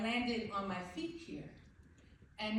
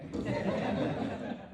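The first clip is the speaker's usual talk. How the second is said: nervous laugh; 3 semitones higher, 8 dB louder than usual. Real, not acted. Nervous laugh at podium start.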